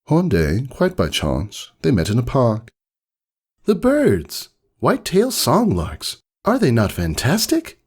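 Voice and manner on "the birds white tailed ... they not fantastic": high, delighted cry about the birds; a thrilled bird lover's outburst; excited. Acted. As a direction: gush with wonder at the songlarks. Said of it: "Something about the tone of".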